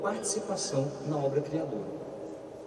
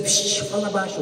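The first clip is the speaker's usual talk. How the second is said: mock hushing father; 7 semitones higher, 6 dB louder than usual. Father hushes the son.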